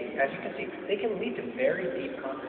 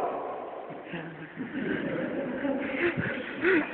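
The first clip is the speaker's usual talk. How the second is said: laughing; 9 semitones higher, 3 dB quieter than usual. Real, not acted. Laughs at Hollywood answer.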